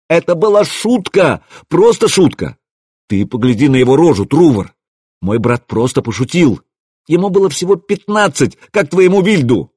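high, agitated outburst; acted outrage with leaping pitch; indignant protest. A narrator's reading. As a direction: protest it desperately. Rasmus heatedly defends dead brother.

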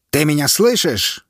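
called out. A narrator's reading. Officer calling to the prisoner, shouted. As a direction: shout to snap him out of it. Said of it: "выкрикнул военный".

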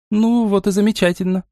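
understated delighted outburst; exclaiming. A narrator's reading. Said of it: "воскликнула Настя".

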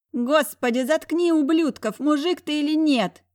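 high shrill shriek. A narrator's reading. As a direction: shriek it at him. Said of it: "Взвизгнула госпожа Седова".

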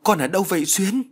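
high-pitched searching call. A narrator's reading. Father calls for missing daughter Xuyến, calling out. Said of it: "Năm Đực lại gọi một lần nữa".

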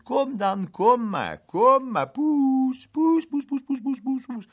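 high, loud sing-song coaxing; coaxing cat call. A narrator's reading. Onlookers coaxing the cat out of the plane tree, high and loud. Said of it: "riep iedereen bemoedigend".

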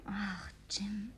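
a breathy whisper, barely audible; soft whisper. Acted. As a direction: whisper his name, moved. Tender moment, hands held.

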